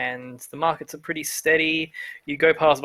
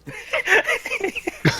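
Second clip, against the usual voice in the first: high burst of laughter. Real, not acted.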